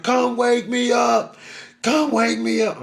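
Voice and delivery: high, loud, slow desperate call; scared child pleading with his sleeping mother; terrified plea. Retold or imitated. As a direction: beg her to wake you, frightened.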